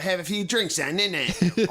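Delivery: put-on Birmingham accent. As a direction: ham up a Birmingham girl's accent. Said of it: "Like, that accent".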